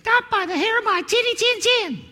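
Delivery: very high squeaky piglet voice; little pig. Acted. Pig answers from inside.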